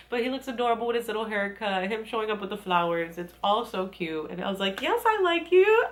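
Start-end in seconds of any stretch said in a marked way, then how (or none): 4.8-5.8 high, loud squeal of delight; gushing delight. Retold or imitated. Fan reacting to a cute confession, gushing squeal.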